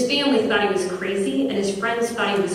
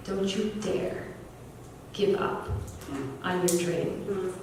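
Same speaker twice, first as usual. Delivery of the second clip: soft, slow, heartfelt plea; hushed retold encouragement with long pauses; solemn urging; 10 dB quieter, slower than usual. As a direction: say it quietly, weighing each phrase.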